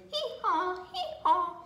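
high, loud hee-haw; imitated donkey braying, pitched high. A narrator's reading. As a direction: bray like a storybook donkey. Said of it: "looked at the pancake and brayed".

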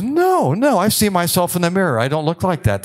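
high, fast, indignant imitation; mock protesting resident. Lecturer imitating a resident denying the video, high and hurried. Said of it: "the resident says".

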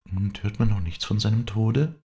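high, concerned question; earnest asking. A narrator's reading. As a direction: ask urgently in a high voice. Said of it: "fragte sie angelegen".

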